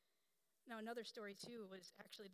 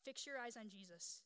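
ordinary delivery

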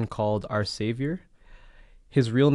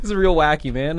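Toronto slang accent; 5 semitones higher, 6 dB louder than usual. Shown as a demonstration. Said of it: "put it in the Toronto voice".

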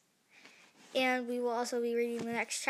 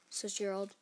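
plain reading voice

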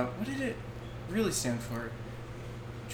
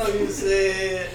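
put-on French accent; 6 semitones higher, 4 dB louder than usual. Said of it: "you sound like that candlestick".

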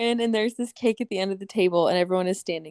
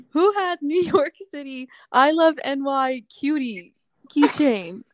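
higher, slow call-out to a crowd; announcing lost keys; 5 semitones higher, slower than usual. Retold or imitated. Finder asks the whole camp whose keys these are, mock announcement. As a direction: slowly read it out for everyone to hear. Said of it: "held up my keys and said".

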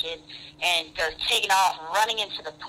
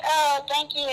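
mock lady; 8 semitones higher, slower than usual. Lady thanks, rolls eyes.

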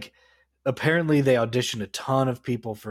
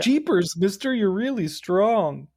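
put-on wholesome 1950s kid; 8 semitones higher than usual. Quoting a kid from the movie.

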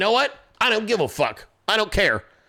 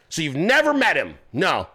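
normal talking voice

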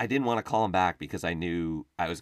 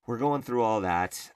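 ordinary delivery

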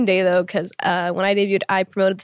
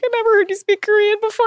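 teasing voice; 13 semitones higher, 3 dB louder, faster than usual. Retold or imitated. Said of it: "He's like".

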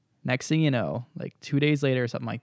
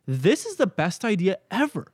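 higher, slow, gushing delight; mock delight; 6 semitones higher than usual. Imagined client gushing over a gift card, slow delight. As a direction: savour every word like a thrilled client.

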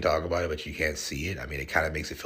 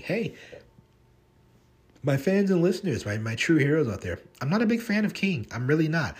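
much higher, louder appeal to the fans; emphatic address; 9 semitones higher, 4 dB louder than usual. Real, not acted. Host confesses to fans and listeners, loud and high, that he's no King fan. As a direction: make it a big confession.

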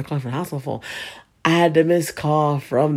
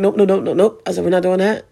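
louder, insistent nopes; firm refusal; 5 semitones higher, 6 dB louder than usual. Retold or imitated. Daughter refusing to let her friend talk about her mom's death, louder and firm. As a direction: shut it down hard.

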